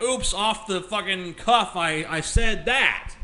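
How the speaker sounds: slow, offhand mock oops; mock careless slip.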